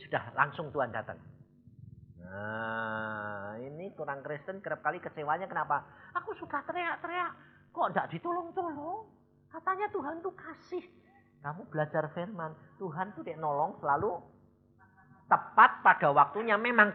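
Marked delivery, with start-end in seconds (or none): 6.2-10.8 high, whiny complaint thrown at God; complaining believer. Retold or imitated. Jemaat kecewa mengeluh kepada Tuhan dengan suara tinggi merengek. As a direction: play the let-down believer griping that no help came.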